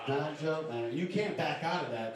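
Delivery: ordinary talk, nothing marked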